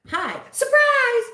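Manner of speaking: high, loud, drawn-out surprise; goofy over-the-top greeting; playful surprise greeting. Acted. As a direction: burst out as if jumping from hiding. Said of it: "I'd be like".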